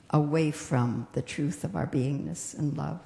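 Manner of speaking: normal talking voice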